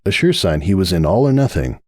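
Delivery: ordinary delivery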